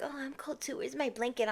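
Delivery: mock her pitiful little whine; quoting a needy friend, soft and whiny; whiny mock complaint.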